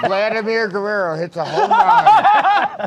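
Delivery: laughing. Real, not acted. Panelist joking about a home run, laughing loudly. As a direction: draw out the call while laughing. Absurd joke amid group laughter.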